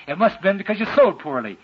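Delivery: ordinary talk, nothing marked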